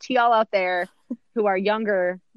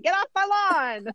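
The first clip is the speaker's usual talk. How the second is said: put-on cranky old person; 12 semitones higher than usual. Joking about becoming that old person, cranky high-pitched cry. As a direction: play a grumpy old person shooing kids. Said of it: "that old person that's like".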